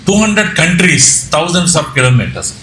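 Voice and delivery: normal talking voice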